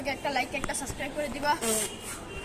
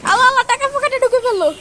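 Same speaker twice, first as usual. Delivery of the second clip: surprised exclaim; 9 semitones higher, 9 dB louder than usual. Real, not acted. Money pocketed, host exclaims.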